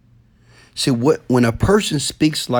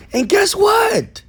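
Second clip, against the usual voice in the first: much higher, slow, ringing shout; preaching shout; slower than usual. Real, not acted. Preacher's climactic call.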